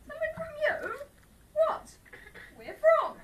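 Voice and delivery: high, loud incredulous echo of the word; shocked disbelief. Acted. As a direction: throw the word back, outraged and amazed. Shocked at accusation of theft.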